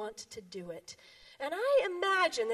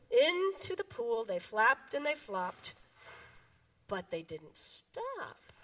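lively picture-book read-aloud; reading to children; 5 dB louder than usual. Acted. Children's time pastor reading a picture book, bouncy. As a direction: read it to the kids with big animal voices.

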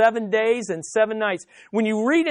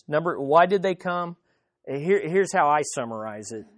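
usual speaking voice

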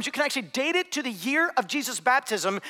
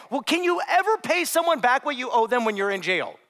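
usual speaking voice

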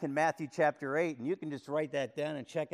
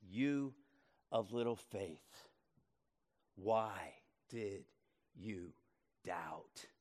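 low, soft, slow and gentle rebuke; quiet rebuke; 9 semitones lower, 9 dB quieter, slower than usual. Acted. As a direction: lower your voice to a tender reproach. Jesus gently rebukes Peter.

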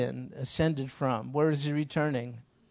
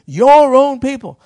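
"your own people" slow, with "your" much higher and louder; stress on your; 10 semitones higher, 17 dB louder than usual. Retold or imitated. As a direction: lean hard into "your", drawn out. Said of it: "he said it like that".

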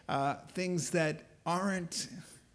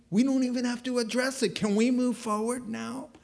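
higher, quick brush-off; impatient; 8 semitones higher, 3 dB louder than usual. Acted. God brushes off Gideon's pity party, impatient. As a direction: hurry past it, mock-exasperated.

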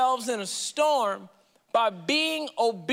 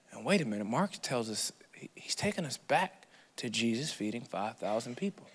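whisper; 12 semitones lower, 8 dB quieter than usual. Real, not acted. Whispered aside.

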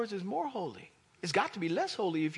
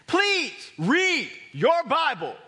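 loud, high, forceful preaching; emphatic; 7 semitones higher, 7 dB louder than usual. Real, not acted. Preacher assuring congregation any Bible version is fine, emphatic. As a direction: punch it out loud and high, leaning on each word. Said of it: "I'm emphasizing that".

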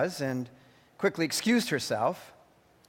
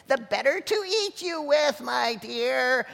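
mock roar; 8 semitones higher, slower than usual. A narrator's reading. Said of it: "roared the wolf".

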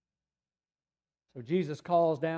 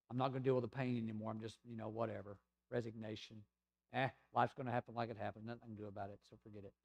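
apathetic shrug; 4 semitones lower, 8 dB quieter, faster than usual. Retold or imitated. Voicing an apathetic resigned person.

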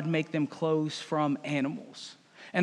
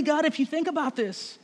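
Adam wheedling; 8 semitones higher than usual. Acted. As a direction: coax God sweetly to see it your way. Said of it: "The man replied".

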